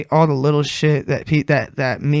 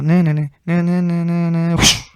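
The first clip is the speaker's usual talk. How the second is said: chanted na na na, then a whoosh; mimicking a trailer beat; 2 semitones higher than usual. Shown as a demonstration. Podcaster showing his trailer ending, lightly chanted. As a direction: sing the beat drop with your mouth. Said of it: "it'll just go".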